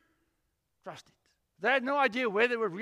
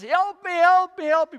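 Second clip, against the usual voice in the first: loud, high cries of help me; frantic shouting for help; desperate yell for help; 8 semitones higher, 11 dB louder than usual. Retold or imitated. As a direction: yell it like a man hanging off a cliff. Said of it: "And he yells".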